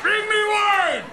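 bellowing imperious emperor, high; roaring tyrant king. Acted. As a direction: roar the order at the waiter. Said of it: "Emperor of Rome attitude".

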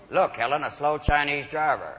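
lightly voiced driver, low and soft; armed motorist. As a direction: point out a target to your wife, low. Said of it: "people with twin fifty caliber machine guns".

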